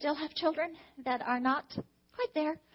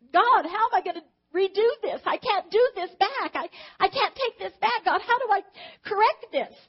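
retold anguished prayer; 5 semitones higher, 6 dB louder than usual. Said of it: "And I just cried".

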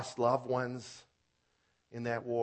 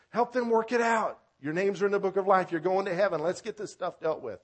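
raised, high, loud pleading as a preacher quoting; loud, high-pitched, pressing appeal; urgent exhortation; 8 semitones higher, 8 dB louder than usual. Acted. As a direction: call out urgently to settle a quarrel. Voices Paul urging fighting sisters.

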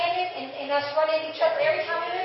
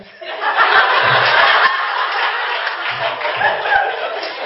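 high, loud rising laugh; big real laugh; 8 dB louder than usual. Real, not acted. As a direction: let a big laugh burst out. Laughs about the workout room.

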